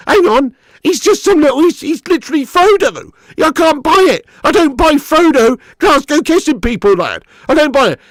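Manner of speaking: high, loud exasperated rant; exasperated disbelief. Real, not acted. Fan ranting about a miscast hobbit, exasperated. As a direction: protest it loudly, pitch right up.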